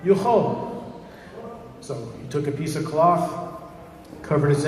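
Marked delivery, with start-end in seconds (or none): 0.0-0.8 higher falling call; a light demonstration of a yell; mock yell. A narrator's reading. Kokoini tells his eye what to yell, mild mock yell. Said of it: "I want you to yell".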